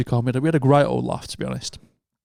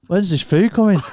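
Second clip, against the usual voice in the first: put-on drunk whine; 6 semitones higher, 3 dB louder than usual. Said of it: "You're like".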